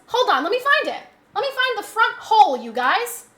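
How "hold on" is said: ordinary talk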